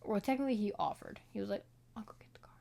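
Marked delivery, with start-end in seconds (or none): 1.9-2.6 breathy whisper of the husband's offer. Retold or imitated. Husband quietly offering to fetch the car, whispered. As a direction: breathe it out, barely voiced.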